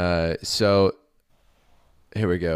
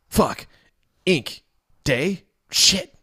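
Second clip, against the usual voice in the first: panicked cursing; 13 semitones higher, 6 dB louder, much slower than usual. Acted. Acolyte wakes to spilled ink.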